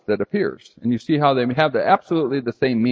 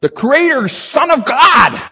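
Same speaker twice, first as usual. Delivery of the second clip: louder and higher, full conviction; emphatic declaration; 9 semitones higher, 6 dB louder than usual. Retold or imitated. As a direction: pound home the authority. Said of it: "He is saying".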